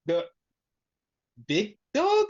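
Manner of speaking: hesitate, then lift "dog?" sharply in doubt; questioning. Retold or imitated. Punk kneels to honor Roman, questioning "big dog?". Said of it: "he questioned that one".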